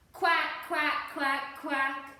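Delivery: loud, booming duck quack; big loud quack. Acted. Storyteller quacks loudly with the children to call ducks back. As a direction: quack loud enough to call every duckling home. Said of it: "Can you help her quack?".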